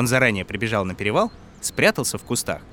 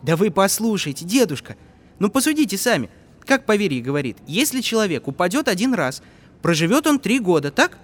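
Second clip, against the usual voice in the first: put-on persuasive boy voice; 8 semitones higher than usual. Boy persuading old man.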